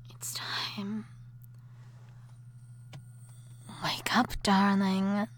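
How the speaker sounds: soft whisper to wake him; tender whisper. Acted. Wife gently whispering her husband awake.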